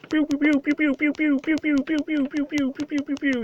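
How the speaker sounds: very high, squeaky pew pew laser sounds; high pew shooting noises. Acted. Gamer voicing his gun shooting the birds, high squeaky pews. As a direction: chirp pew pew like a toy gun.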